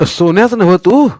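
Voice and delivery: put-on loud, high old farmer calling out; a gruff village elder booming at a boy; old Patil's voice. A narrator's reading.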